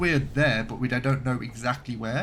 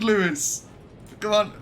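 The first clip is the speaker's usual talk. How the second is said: excited urging; 8 semitones higher, 5 dB louder than usual. Real, not acted. Urging crew under fire.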